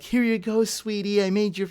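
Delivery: a light hint of a doting auntie serving; sweet server voice.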